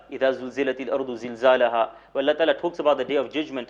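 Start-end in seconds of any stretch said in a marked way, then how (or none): 0.1-1.9 Quran recitation. Retold or imitated. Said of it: "he recited Surah Zilzal".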